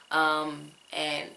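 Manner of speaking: normal talking voice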